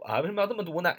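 ordinary delivery

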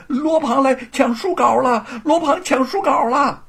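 loud, high panicked shout; shouting alarm. Acted. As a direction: yell a warning while running. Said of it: "一边大喊". (大声惊叫报信)

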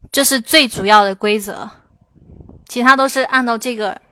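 usual speaking voice

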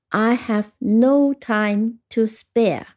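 very slow, each word held; model sentence reading. Shown as a demonstration. Teacher repeating the sentence for learners, slow model reading. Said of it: "再听一遍".

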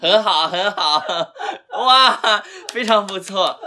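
giggling through words; laughing. Real, not acted. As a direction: praise while laughing. Laughing praise.

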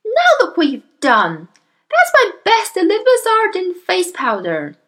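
high, cross outburst; shrieking mother. Acted. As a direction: scold loudly over the spilt powder. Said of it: "shrieked the mother".

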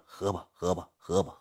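very soft, low, under-the-breath drink up; muttered urging. Retold or imitated. Cui quietly telling him to drink up, very soft. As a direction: murmur it almost to yourself.

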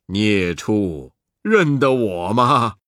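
low voice with a chuckle; a hint of a chuckling Buddha; laughing. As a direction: tease the beast through a laugh. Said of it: "嘻嘻笑着叫道".

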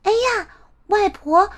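put-on high child voice, wondering; startled little girl.

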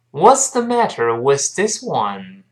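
slow, evenly spaced teaching model; slow reading. Shown as a demonstration. Teacher leading learners through the sentence, slow reading. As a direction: read it out slowly, word by word. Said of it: "我们慢慢读一下这句话".

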